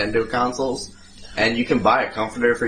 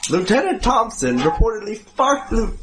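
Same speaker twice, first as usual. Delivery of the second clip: put-on old newsreel; 9 semitones higher than usual. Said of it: "old newsreels".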